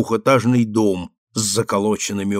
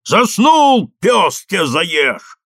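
high, loud, drawn-out holler; rough yell; 6 semitones higher, 4 dB louder, much slower than usual. A narrator's reading. Workman's voice from the wall orders bricks, yelling roughly. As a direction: bellow an order, stretching the words. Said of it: "заорал из стены грубый голос".

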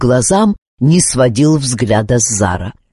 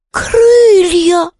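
ordinary delivery

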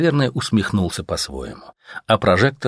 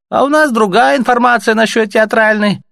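higher, louder, coolly indifferent; indifferent officer; 6 dB louder than usual. A narrator's reading. Reich officer dismissing Artyom, indifferent. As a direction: brush him off without interest. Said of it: "равнодушно отозвался Дитмар".

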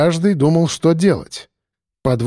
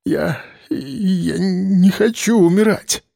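high, slow, halting plea; stammering mumble; 5 semitones higher, slower than usual. A narrator's reading. Policeman admits fear of dying, stammering. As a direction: stammer it out fearfully. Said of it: "пробормотал он, заикаясь".

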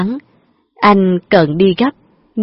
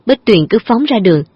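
ordinary delivery, nothing marked

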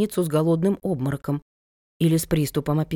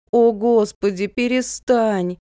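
exasperated plea, raised and drawn out; higher, louder, slow imploring cry; pleading; 5 semitones higher, 4 dB louder, slower than usual. A narrator's reading. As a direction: beg her to stop, stretching the words. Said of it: "взмолилась я".

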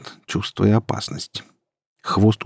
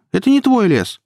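put-on high, defiant girl's cry; indignant high-pitched protest; shouting; 4 dB louder than usual. A narrator's reading. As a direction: throw it back at him, voice way up. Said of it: "крикнула Кира".

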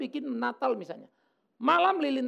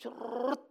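quick rolled "crrrt" sound effect; imitated zipping noise; 3 semitones higher, 5 dB quieter than usual. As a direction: zip out a trilled whoosh of speed. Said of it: "cepat sekali kan berpikirnya". (tiruan bunyi melesat "cerrrt")

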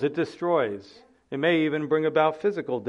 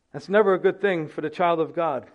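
usual speaking voice

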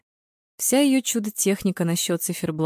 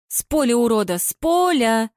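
outraged cry, rising higher; straining yell; 4 semitones higher, 3 dB louder than usual. A narrator's reading. Marina demanding a sending-off, straining to yell. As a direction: yell yourself hoarse in outrage. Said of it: "надрывалась Марина".

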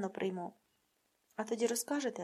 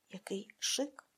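softly whispered praise; whispered admiration; 3 semitones lower, 5 dB quieter, slower than usual. Acted. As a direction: breathe it like a secret marvel.

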